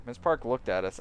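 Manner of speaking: ordinary talk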